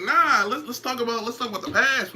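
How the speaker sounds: high, teasing sing-song taunt; mock taunt. Streamer teasing a subscriber in a high mocking voice.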